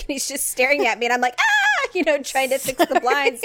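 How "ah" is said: very high, loud mock shriek; hammed-up yelp of panic; acted panicked scream. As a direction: scream as if it's all collapsing. Re-enacts panic as blind falls.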